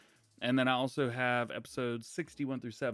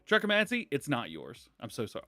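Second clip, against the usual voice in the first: ordinary talk, nothing marked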